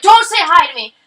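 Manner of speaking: loud, high snap; an angry customer in the shop, mock shouting. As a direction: bark it like a furious shopper. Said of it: "She's like".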